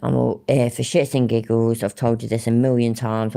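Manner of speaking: usual speaking voice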